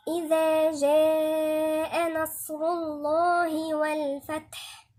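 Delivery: slow, measured tajweed recitation; unhurried, even-toned chanting; Quran recitation. Shown as a demonstration. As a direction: recite it with long drawn-out vowels.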